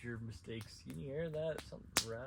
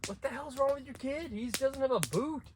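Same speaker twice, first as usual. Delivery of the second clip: high, loud mock shout from dad's friend; a mocking high yell over the kid's lost boot; Mike yelling; 6 dB louder than usual. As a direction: yell it like a startled adult. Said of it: "says to him".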